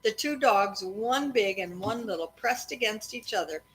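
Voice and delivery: steady read-aloud storytelling voice; story narration. A narrator's reading. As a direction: read it evenly to the kids at bedtime. Parent reading a chapter aloud.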